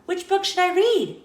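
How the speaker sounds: put-on a kid bouncing with questions; excited Pete. Pete asking the librarian which book to read, excited.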